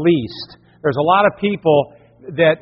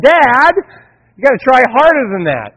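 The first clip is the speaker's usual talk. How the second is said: put-on higher, louder child protest; insistent daughter; 6 semitones higher, 4 dB louder than usual. Daughter urges dad, higher and insistent. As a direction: push dad to try harder. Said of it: "She goes".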